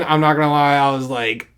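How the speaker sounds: usual speaking voice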